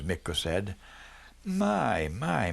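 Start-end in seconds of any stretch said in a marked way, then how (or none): 1.4-2.5 a high, pleased exclamation of surprise; surprised. Acted. Mother caught off guard by good news, surprised and pleased. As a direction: let it leap up in pleased surprise. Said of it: "Mother sounded surprised".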